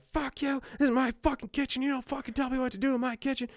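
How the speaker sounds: hammed-up rage at the cook, high and quick; mock furious shout. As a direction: producer yelling at the cook in his kitchen, mock furious. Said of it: "John Peters is like".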